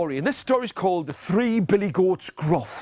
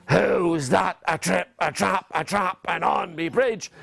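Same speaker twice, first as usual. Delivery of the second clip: scary troll; 3 semitones lower than usual. Acted. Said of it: "up jumped the hairy, scary troll".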